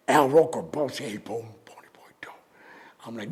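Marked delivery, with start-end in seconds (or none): 1.0-2.3 fast hushed warning; urgent whisper. Retold or imitated. Co-host whispering a warning not to smoke. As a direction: whisper it quickly so the mic misses it. Al Roker warns off-mic.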